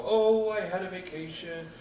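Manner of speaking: dismissive sing-song, loud la la la; mock the boring topics with a loud singsong. Mocking boring essay topics.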